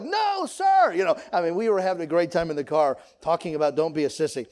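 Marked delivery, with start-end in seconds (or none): 0.0-0.9 put-on much higher, squeaky little-kid answer; kid's eager reply. Child answering dad in the car, high eager reply. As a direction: chirp it like an eager kindergartner. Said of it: "And we were like".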